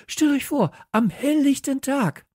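very high, loud agitation; high-pitched, loud excitement; excited cry. A narrator's reading. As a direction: exclaim it in scandalized amazement. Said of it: "rief Kalle".

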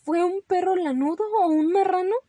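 high, loud, incredulous question; insistent questioning. Acted. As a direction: press the little fibber for the answer. Mother questioning Ramón's lies.

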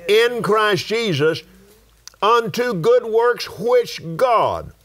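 high, slow, declaimed verse; scripture reading. Real, not acted. Preacher reading Ephesians to the congregation, high declaimed scripture.

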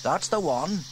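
put-on high boy voice, unsure; young boy James shrugging it off. A narrator's reading. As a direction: voice the young brother. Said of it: "agreed her brother".

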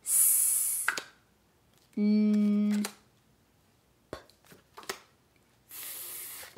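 isolated, drawn-out letter sounds. Shown as a demonstration. Teacher models sounds for pupils to say, isolated phonics sounds. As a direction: sound out each letter by itself, not its name. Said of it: "Now we're gonna do the sounds".

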